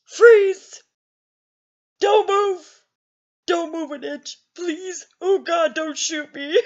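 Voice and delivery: put-on frantic teen repeating the cop; hysterical. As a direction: hysterical retold panic. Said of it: "I was hysterical".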